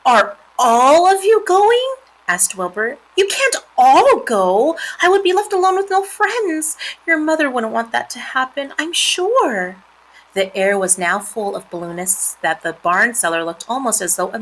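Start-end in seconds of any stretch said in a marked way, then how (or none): 0.0-2.0 high, loud, drawn-out question; dismayed pig. A narrator's reading. Wilbur fears being left alone, high dismayed voice. 3.1-9.8 higher pleading pig voice; dismayed pig. A narrator's reading. Wilbur begs spiders not to go, high pleading voice.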